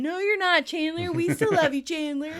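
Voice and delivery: high, loud, gushing consolation; mock reassurance. Friends Chandler hoped would console him, loud mock gushing. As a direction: overplay the comforting friends.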